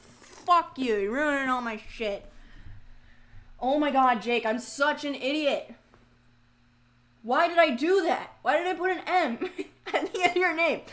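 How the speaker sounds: loud, high-pitched exasperation; really mad. Real, not acted. Streamer apologizing to Jake for a misspelling, mad at self. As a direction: berate the viewer, then yourself. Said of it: "I'm so mad right now".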